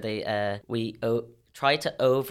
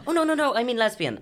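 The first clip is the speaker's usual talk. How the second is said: put-on a light, higher little girl voice; imitating her niece; 11 semitones higher than usual. Niece correcting herself to aunt, lightly voiced higher child. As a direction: play the eager eight-year-old correcting herself. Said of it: "And then she went".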